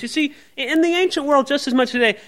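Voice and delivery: ordinary talk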